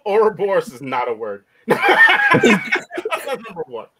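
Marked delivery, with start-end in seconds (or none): 1.6-3.9 words breaking into laughter; laughing through the line. Real, not acted. Laughing at being teased.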